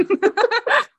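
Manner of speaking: high, loud squeal of laughter. Real, not acted. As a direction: burst out laughing. Laughs at own joke.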